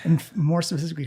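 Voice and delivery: ordinary talk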